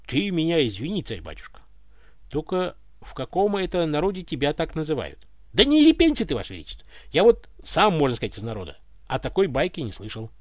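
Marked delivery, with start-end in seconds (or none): none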